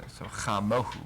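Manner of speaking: chamohu with the stress on hu; chamohu, stressed at the end; stress on the last syllable. Shown as a demonstration. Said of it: "emphasis right here".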